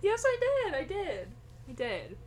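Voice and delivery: high, laughing, protesting too much; overly insistent. Real, not acted. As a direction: convince yourself, fading out. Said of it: "Who are you trying to convince".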